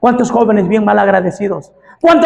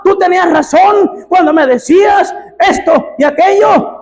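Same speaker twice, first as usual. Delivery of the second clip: predicando a gritos, voz alta y aguda; predicación a todo pulmón; 7 semitones higher than usual. Acted. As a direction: clama como predicador encendido. Preacher voicing prodigal son's plea. (predicador gritando la súplica del hijo pródigo)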